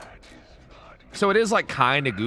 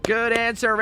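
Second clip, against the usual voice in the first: mock game show cheer; 8 semitones higher, slower than usual. Family Feud cheer.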